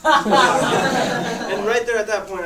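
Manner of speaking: bursting into slow laughter mid-sentence; can't get the words out for laughing. Real, not acted. Telling friends about the doctor scene, laughing hard.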